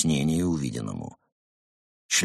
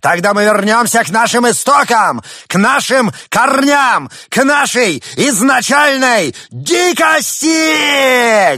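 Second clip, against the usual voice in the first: slow build to a high shout; rising to a shout; 14 semitones higher, 9 dB louder, slower than usual. Acted. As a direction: swell from loud to screaming. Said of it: "постепенно повышая голос".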